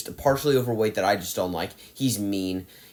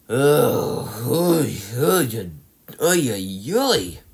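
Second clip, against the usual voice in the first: pained ugh then a string of oy, oy; weary oy-oy groans of dread; exasperated groaning; much slower than usual. Real, not acted. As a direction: groan like the movie physically hurts. Groaning over the next movie.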